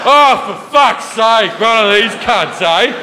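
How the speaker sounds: slow, hammed-up Australian grumble; Australian accent, dragged out in mock outrage. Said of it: "all the Australians behind me".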